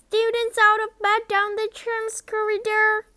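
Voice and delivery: high, loud gleeful bellow. Acted. Peeves alerting the castle, loud high bellow. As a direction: yell it down the halls to raise alarm. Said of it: "Peeves bellowed".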